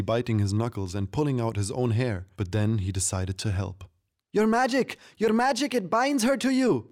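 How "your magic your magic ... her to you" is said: very high, frantic yell; excited little creature calling out; Pimpkin yelling. A narrator's reading. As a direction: shout it in a high squeaky voice. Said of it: "Pimpkin yelled".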